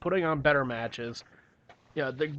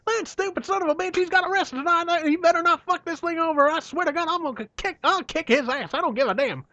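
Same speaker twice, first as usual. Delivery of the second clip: high furious Southern rant; Cornette impression. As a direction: fume about the idiot wrestler. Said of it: "He's like".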